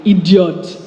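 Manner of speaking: mock Nigerian-accented "Idiot" with a laugh; a laughing Nigerian-accent jab at a driver; Nigerian-accented insult. Cursing crazy Chicago drivers, light Nigerian accent. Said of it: "calling the crazy drivers around me".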